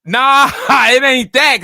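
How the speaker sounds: loud, drawn-out "nah" with a laugh; laughing protest. Real, not acted. Son denying his friend's claim, loud laughing protest. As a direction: shout it down through a laugh.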